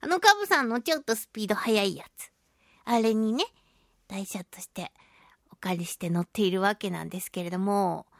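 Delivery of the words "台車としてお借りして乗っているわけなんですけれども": hushed whisper, much softer; whispered aside. Real, not acted. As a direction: drop to a secret whisper. Quiet aside about loaner bike. (代車の話をこっそり打ち明けるささやき)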